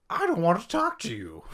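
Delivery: crazy voice, very high and loud; over-the-top cartoonish brush-off; Jenkins. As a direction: refuse to talk as a ridiculous, hammed-up character. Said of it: "unless you just use a crazy voice".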